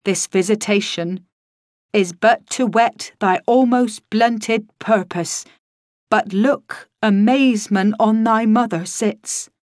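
slow, declaiming stage ghost; lightly voiced Shakespearean ghost, slow and grand; theatrical ghost. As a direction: intone it like a hammy stage ghost. Ghost's Shakespeare lines acted.

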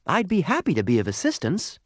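high little coal voice, obliging; small, high, polite talking object; helpful lump of coal. Acted. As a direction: offer help gladly, pitched well up. Coal offers help to Jasper.

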